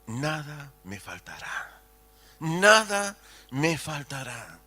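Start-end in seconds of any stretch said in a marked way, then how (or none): 0.1-1.8 quiet, slow savouring of the verse; soft recitation. Acted. Preacher reciting the psalm to the church, soft and slow. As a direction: let it settle softly.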